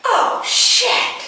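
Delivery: breathy, laughing oh shit; laughing exclamation. Retold or imitated. Mother realises the light is real, laughing surprise. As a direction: gasp it out in amused shock.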